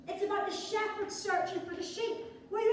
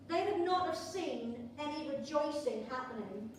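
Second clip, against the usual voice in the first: ordinary talk, nothing marked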